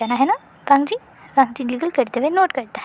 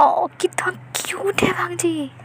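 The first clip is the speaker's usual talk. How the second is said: high, slow breathless coo; high cooing whisper; 5 semitones higher, slower than usual. A narrator's reading. Teen gushing over his baby cousin, high whispered coo.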